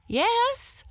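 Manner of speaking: very high squeaky yes; a tiny animal chirping yes; eager hare. Acted. Hare answers the lion.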